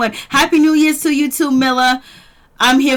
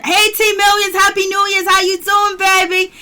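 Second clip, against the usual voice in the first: high, bright-eyed shout-out to a viewer; excited greeting; 8 semitones higher than usual. Real, not acted. Greeting a viewer who joined the live chat, excited high call-out.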